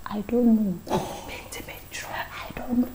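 soft unvoiced whisper; whispered reply. Girl whispering to the boy in the exam, hushed. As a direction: answer without being caught. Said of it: "Na mede sɛ".

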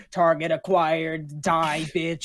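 slow, drawn-out killer robot. Retold or imitated. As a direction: spell out each word like a machine. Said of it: "me and Ovek know what it would say".

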